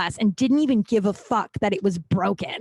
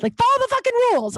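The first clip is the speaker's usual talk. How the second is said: high, fast exasperated snap; exasperated outburst; 14 semitones higher, faster than usual. Retold or imitated. Waitress venting to the manager, high fast exasperated. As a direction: blurt it out, fed up and fast.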